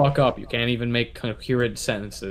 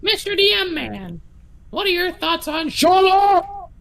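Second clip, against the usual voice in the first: squeaky high character voice; high put-on voice; 3 dB louder than usual. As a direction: pitch way up, sing-song.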